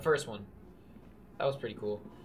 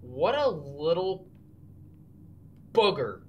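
ordinary delivery

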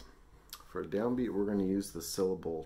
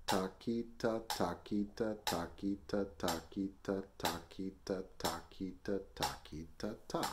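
brisk, even triplet syllables locked to the click; counting at tempo; 3 dB quieter, faster than usual. Shown as a demonstration. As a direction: rattle off threes in strict metronome time. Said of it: "Try that with the metronome".

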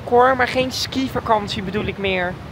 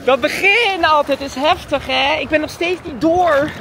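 usual speaking voice

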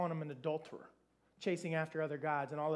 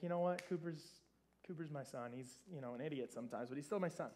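much softer, lower, tender patient father voice; fond gentle God; 5 semitones lower, 9 dB quieter, faster than usual. Retold or imitated. God speaking fondly of his foolish son, soft tender voice. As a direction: let it fade soft like a forgiving father.

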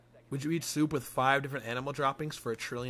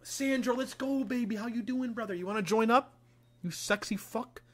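high excited hello to a friend; excited greeting; 9 semitones higher, 3 dB louder than usual. Real, not acted. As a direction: greet him with big energy.

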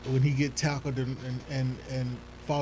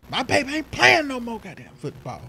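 mock angry mom; 9 semitones higher than usual. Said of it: "She was like".

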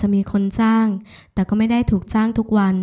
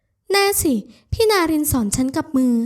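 insistent woman character; 7 semitones higher than usual. A narrator's reading. Woman insists on her cooking. (ยืนยันเสียงสูงอย่างมั่นใจ)

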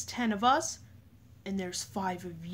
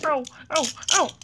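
panicked; 5 semitones higher, 8 dB louder than usual. Pretend. Toy character panics.

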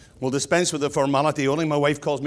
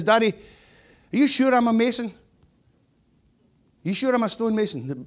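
high, mildly plaintive questioning; a hint of anxious apprentice, joke-telling; lightly voiced doubting son; 9 semitones higher, 3 dB louder than usual. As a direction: lightly play a kid pestering his dad.

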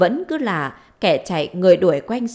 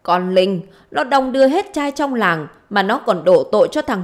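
higher, raised-voice acted grievance; indignant complaint; 3 semitones higher, 4 dB louder than usual. Mother complaining to her daughter about husband's children, indignant. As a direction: pour out accusations with rising pitch.

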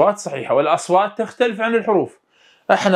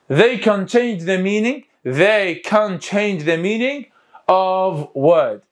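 very slow, slightly raised pitch; slow dictation; much slower than usual. Shown as a demonstration. Teacher drills the definition for the exam, slow dictation. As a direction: stretch each word out for learners.

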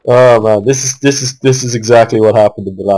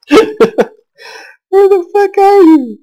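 laughing disbelief; much slower than usual. Real, not acted. Frustrated searching for hidden enemy.

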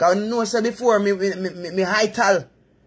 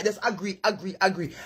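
soft urging; 2 semitones lower, 7 dB quieter than usual. Real, not acted. Soft repeated advice.

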